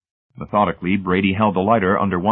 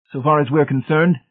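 high, insistent Brady voice; inexorable; 8 semitones higher, 4 dB louder than usual. A narrator's reading. Brady rejecting Hamilton, inexorable. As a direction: press on without yielding, pitched up. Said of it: "Brady continued inexorably".

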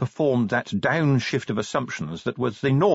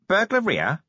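nasal corporal voice; 9 semitones higher than usual. Acted. Nobby voiced in read story.

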